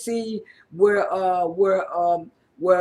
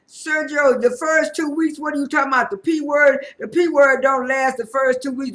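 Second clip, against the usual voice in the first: higher, louder retold telling-off; mock scolding; 6 semitones higher, 5 dB louder than usual. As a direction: act out friends incredulously correcting him. Retold group scolding Sergio's ignorance.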